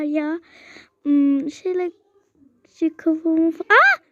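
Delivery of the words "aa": sudden high, loud yelp; high sharp shriek of surprise; startled cry. Real, not acted. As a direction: yelp as something hits you. Something falls on her head.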